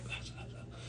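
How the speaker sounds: barely audible whispered chatter; whispering. Acted. Joining a quiet room's talk, whispering. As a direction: whisper it under your breath. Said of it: "Why are you whispering?".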